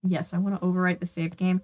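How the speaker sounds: ordinary delivery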